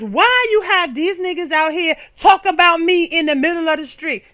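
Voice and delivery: loud, high indignant rant; mock indignant rant. Real, not acted. Comic blaming her man for the hecklers. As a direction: rant about the injustice. Ranting about Dennis.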